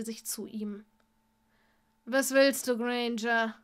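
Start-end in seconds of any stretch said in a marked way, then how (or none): 2.0-3.5 strained groan through the words. A narrator's reading. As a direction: push it out with a pained groan. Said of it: "ächzte Draco".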